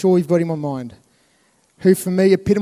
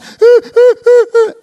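high mock shriek; 10 dB louder than usual. Dog barks, Tobias freaks.

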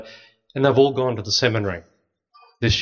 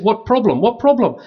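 mock bewildered; 9 semitones higher, 3 dB louder than usual. Scared guide asks, retold.

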